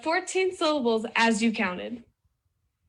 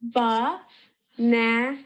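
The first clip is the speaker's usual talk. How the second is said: "Ba-na-na" broken into three drawn-out beats; sílaba por sílaba; much slower than usual. Shown as a demonstration. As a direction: say "Ba-na-na" slowly, each syllable separate. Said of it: "how many times your jaw touches".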